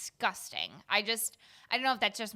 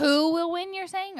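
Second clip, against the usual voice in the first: confused; 9 semitones higher, 8 dB louder than usual. Real, not acted. Confused question.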